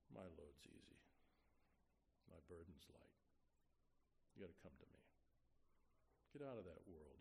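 Jesus tenderly calling Bobby home, softly. Acted. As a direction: speak it like an arm around the shoulder. Jesus voiced speaking to sinner.

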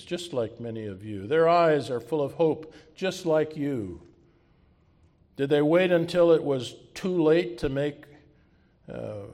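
loud, rousing teacher speech; rally the class like a passionate teacher; Keating's speech. Retold or imitated. Preacher acting Keating's speech.

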